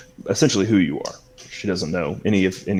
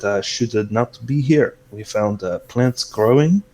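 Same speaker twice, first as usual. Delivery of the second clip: put-on accented confiding host. Celeste sharing news not for uninitiated ears, accented and confiding. Game master plays Celeste.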